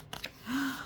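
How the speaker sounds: sharp surprised gasp; quick intake of breath. Acted. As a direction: gasp in delight at the picture. Reader gasps at animals.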